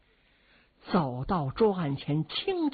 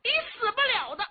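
loud curse rising much higher; furious old lady; 5 semitones higher, 4 dB louder than usual. A narrator's reading. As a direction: curse the old man in fury. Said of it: "老太太气坏了".

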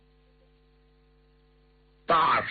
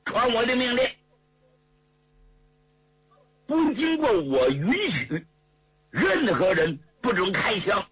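stern order in a raised pitch; commanding marshal; 7 semitones higher than usual. Acted. Marshal forbidding his men to open fire, commanding and high-pitched. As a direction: proclaim it to the troops, high and emphatic.

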